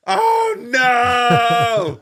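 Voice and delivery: high, drawn-out panicked shout; loud, high cry of dismay; horrified cry. Retold or imitated. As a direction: wail it out in sheer horror. Reliving horror at the eye poke.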